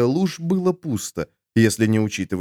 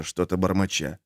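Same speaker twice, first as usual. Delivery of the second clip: ordinary delivery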